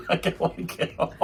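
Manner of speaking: mock whiny moaning, laughing through it; hammed-up complaint breaking into laughs; complaining tone. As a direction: imitate a friend grumbling the whole way. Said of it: "you were taking a tone the whole way".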